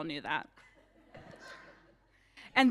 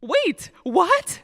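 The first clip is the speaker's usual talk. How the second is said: mock surprise; 8 semitones higher, 7 dB louder than usual. Reader feigns shock at book.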